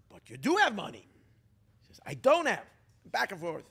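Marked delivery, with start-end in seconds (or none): 0.1-1.1 insistent accusation. Retold or imitated. Quarrel over hidden money. 2.1-2.6 loud denial. Retold or imitated. Quarrel over hidden money.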